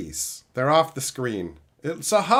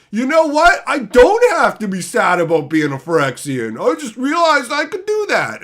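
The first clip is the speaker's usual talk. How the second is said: hammed-up cheery Ajani; 7 semitones higher, 7 dB louder than usual. Voicing Ajani's sudden turn.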